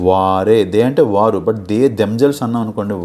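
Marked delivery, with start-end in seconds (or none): 0.1-0.6 వారే loud with a long first vowel; drawn-out వారే, rising at the end; emphatic stress. Shown as a demonstration. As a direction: punch and stretch వారే. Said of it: "emphasize చేసి చెప్తున్నాం".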